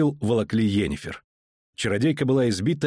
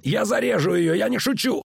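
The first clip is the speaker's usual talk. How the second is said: yelling thug; 10 semitones higher than usual. A narrator's reading. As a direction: scream the threat to keep him back. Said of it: "заорал он".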